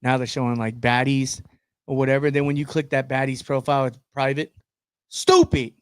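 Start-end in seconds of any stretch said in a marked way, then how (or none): 5.1-5.7 squeaky scornful "Stupid" up high; exasperated high exclamation. Real, not acted. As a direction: toss off the word in disgust. Mock complaint about private profiles.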